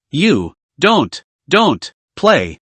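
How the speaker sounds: shaken, broken stammer; can't get the words out. A narrator's reading. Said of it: "couldn't speak clearly".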